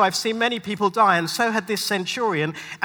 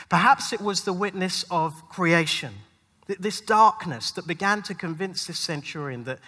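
ordinary talk

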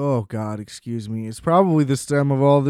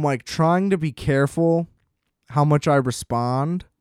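ordinary talk, nothing marked

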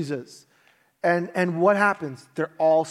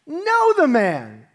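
slow, louder, higher "know the man"; sworn loud denial; 6 semitones higher, 4 dB louder, much slower than usual. Retold or imitated. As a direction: spit out the denial with curses. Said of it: "he swore to them".

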